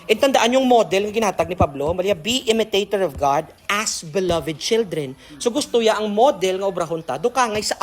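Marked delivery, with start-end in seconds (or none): 2.1-5.2 solemn scripture reading. Acted. Rereading the scripture verse.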